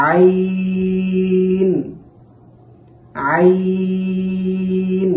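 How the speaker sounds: long sung Ain from the throat; Arabic letter demo. Shown as a demonstration. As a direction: chant আইন slowly from the middle of the throat. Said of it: "এই আইন উচ্চারণের সময়". (কণ্ঠনালী থেকে টেনে আইন)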